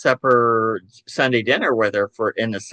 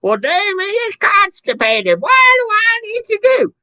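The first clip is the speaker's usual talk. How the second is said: much higher, fussy busybody on the phone; gossiping phone voice; 4 dB louder than usual. Retold or imitated. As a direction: announce it to the whole town, high and prim. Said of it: "on the telephone going".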